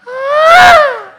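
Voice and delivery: long, very high shriek; panther-like scream. Retold or imitated. Terrified rider plunging down, long high panther shriek. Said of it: "I sound like a panther".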